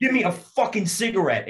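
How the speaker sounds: fuming recruit demanding a smoke from his buddy; furious demand. Retold or imitated. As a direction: spit it out through the anger. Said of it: "I turned to Badge and I went".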